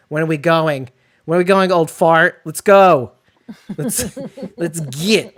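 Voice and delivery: loud, high mock Texas twang; hammed-up Texas accent, urging; mock Texas drawl. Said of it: "as you would say in your backwards Texas accent".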